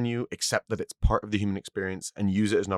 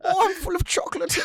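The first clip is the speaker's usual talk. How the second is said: very high mock German voice; German accent; 3 dB louder than usual. Joking on after co-host's request, silly high German accent. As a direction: keep up the hammed-up German, pitched way up. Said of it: "do it in your best German accent".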